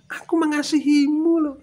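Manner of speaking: high, loud mock declaration of love; showy, high-pitched exclamation; professed love. Acting out an empty love declaration.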